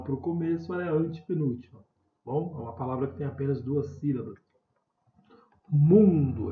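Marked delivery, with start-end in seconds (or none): 5.7-6.5 "Mundo" with MUN loud and drawn out; teacher's emphatic MUN-do demo of "Mundo"; stressed penultimate syllable. Shown as a demonstration. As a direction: punch the first syllable of "Mundo". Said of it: "é a mesma coisa".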